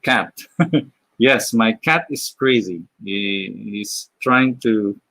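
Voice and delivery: normal talking voice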